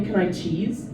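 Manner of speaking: usual speaking voice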